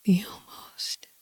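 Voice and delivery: hushed, fading whisper. A narrator's reading. Lover explaining why the veil stays, in a fading whisper. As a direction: let it sink away into pure breath. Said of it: "you whisper".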